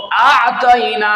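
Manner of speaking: high, loud, drawn-out আ'তাইনা; teacher's correct recitation. Shown as a demonstration. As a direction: hold আ'তাইনা long with pressure in the throat. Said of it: "ওস্তাদে আমাকে বলছে, "পড়ো".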